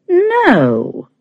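high, drawn-out wounded denial; hurt voice. A narrator's reading. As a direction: let it fall from high, a little wounded. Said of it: "said Leah in a hurt voice".

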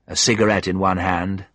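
ordinary delivery

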